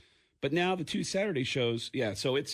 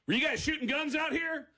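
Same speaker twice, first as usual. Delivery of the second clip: high-pitched, demanding cop question; stern cop; 11 semitones higher than usual. Retold or imitated. Cop getting out of patrol car, stern demand. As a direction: imitate an officer challenging suspects. Acts out cop confronting them.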